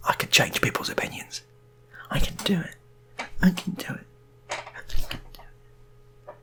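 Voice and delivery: breathy whisper, fading to almost nothing; whispered self-talk. Acted. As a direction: whisper it to yourself, talking yourself into it. Brian's inner self-persuasion.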